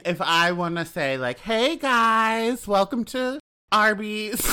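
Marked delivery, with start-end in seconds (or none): 1.4-4.5 higher, bright chirpy welcome; feminine greeting. Shown as a demonstration. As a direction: greet like a bubbly cashier. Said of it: "So, like, I'm feminine".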